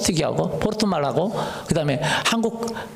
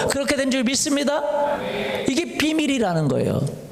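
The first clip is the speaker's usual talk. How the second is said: higher, rising preaching cadence; fervent preaching; 8 semitones higher than usual. Acted. Pastor preaching to the congregation with rising fervour. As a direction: build the fervour line by line.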